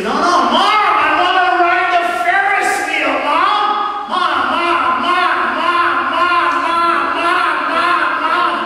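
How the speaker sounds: high, loud, insistent calling of Mom; a kid badgering his mother for a ride; whiny kid nagging.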